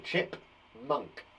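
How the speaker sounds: Chip, monk split into two slow parts; sounding out words. Shown as a demonstration. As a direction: say chip and monk as separate syllables. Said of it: "split it in two".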